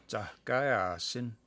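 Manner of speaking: Tahkaya Sin drawn out slowly, rising at the end; slow word demo. Shown as a demonstration. Showing viewers the diminutive ending, said slowly. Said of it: "that S I N on the end".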